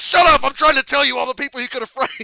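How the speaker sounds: high, loud furious shout, rushed fast; furious yelling. Retold or imitated. Cena cutting off the guy's line, yelling and fast. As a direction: shout him down and race through the rest. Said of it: "he goes".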